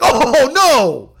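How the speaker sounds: loud, high drawn-out oh no; retold loud cry of dismay; dismayed outburst. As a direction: wail it in exaggerated horror. Retold leap to feet in dismay.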